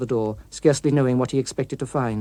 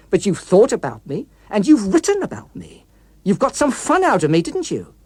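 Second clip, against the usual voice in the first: higher, louder, building threat; rising menace; 4 semitones higher, 4 dB louder than usual. Acted. The villain threatening the author who created him, rising menace. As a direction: taunt him, getting angrier line by line.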